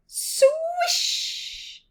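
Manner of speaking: high, airy swoosh of a swing; swish sound effect. A narrator's reading.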